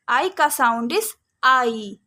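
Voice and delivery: slow teaching emphasis. Shown as a demonstration. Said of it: "long sound".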